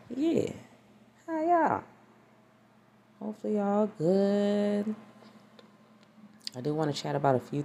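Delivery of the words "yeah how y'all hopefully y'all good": high, drawn-out hello; sing-song greeting. Real, not acted. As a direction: greet viewers in a high lilt.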